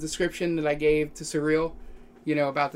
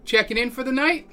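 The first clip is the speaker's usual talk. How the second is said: higher-pitched front desk clerk, lifting into the question; desk clerk character; 6 semitones higher, 3 dB louder than usual. Acted. Clerk asking guests about checking in, higher character voice. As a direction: play the clerk, pitched up above your own voice. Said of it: "he goes".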